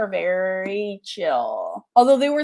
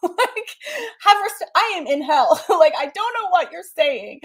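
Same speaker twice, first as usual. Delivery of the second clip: laughing exasperation; 9 semitones higher, 4 dB louder than usual. Real, not acted.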